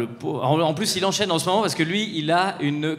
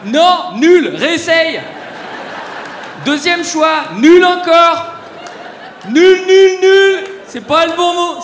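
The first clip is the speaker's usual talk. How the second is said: high, loud laughing verdicts; hammed-up jeering from the stage; mock shout; 12 semitones higher, 11 dB louder, much slower than usual. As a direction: shout them down like a game show host. Mock game-show buzzer verdicts.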